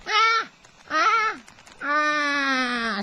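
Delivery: high, loud imitated crow caw; Australian bird impression; raven caw imitation. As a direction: squawk out caws like a raven, the last drawn out. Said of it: "noise which goes like".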